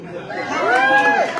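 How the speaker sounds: high, drawn-out call to the crowd; excited greeting. Real, not acted. Party chair greeting the voters in the room, high and slow. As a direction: sing it out to the whole room, slowly.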